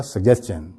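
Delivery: "سجیشن" run together as sug-jes-chun; fluent correct pronunciation. Shown as a demonstration. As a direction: flow through "سجیشن", ending in CH. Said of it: "روانی میں". ("سجیشن" روانی میں "سگجیسچن")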